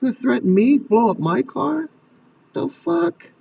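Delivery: high, incredulous indignation; outraged parent. Acted. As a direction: react to a threat in high-pitched disbelief. Voices parent reacting to the threat.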